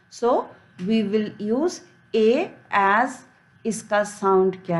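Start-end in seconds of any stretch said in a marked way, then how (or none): none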